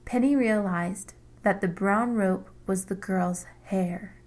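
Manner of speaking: plain reading voice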